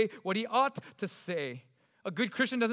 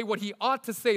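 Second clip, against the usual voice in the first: ordinary talk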